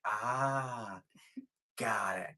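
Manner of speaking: hushed whisper, barely voiced. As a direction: whisper it like a quick aside. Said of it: "I'm like".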